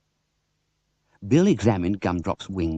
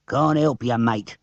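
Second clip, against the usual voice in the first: growl; slower than usual. Acted. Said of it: "growled the driver".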